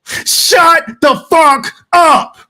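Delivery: high-pitched yell, drawn out at the end; fed-up outburst, each word stretched; exasperated shout. Real, not acted. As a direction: shut him down with a slow, punched-out yell. Fed-up outburst at the boxer's demands.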